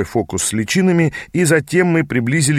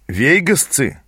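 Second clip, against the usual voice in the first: scornful disbelief, pitched higher; incredulous amusement; 4 semitones higher, slower than usual. A narrator's reading. Youth scoffing at the rival army, incredulous. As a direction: echo the name as if it were absurd. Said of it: "недоверчиво рассмеялся он".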